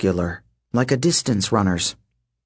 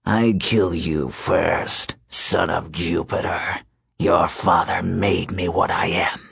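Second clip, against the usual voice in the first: low menace tinged with regret; regretful wolf king; 5 semitones lower than usual. Acted. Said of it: "Lycaon said with regret".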